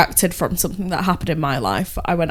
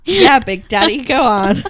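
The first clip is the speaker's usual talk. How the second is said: loud, higher, laughing mock encouragement; mock bedroom urging; 4 semitones higher, 6 dB louder than usual. Friend joking as a woman on top, loud mock urging. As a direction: egg them on loudly, hammed-up.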